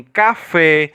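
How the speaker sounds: slow "café", weight on the final FÉ; last-syllable stress. Shown as a demonstration. Teacher giving students an oxytone example, stress on final FÉ of "café". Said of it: "com um acento na última sílaba".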